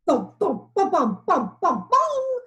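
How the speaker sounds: loud rhythmic thumping chant; coconut thuds. A narrator's reading. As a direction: mimic falling coconuts. Falling coconuts sound.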